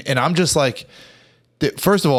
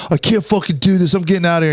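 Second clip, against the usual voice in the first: high-pitched retold freak-out; mock panic; 8 semitones higher, 4 dB louder, faster than usual. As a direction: imitate someone bailing out, pitched up. Acting Keith bailing out in panic.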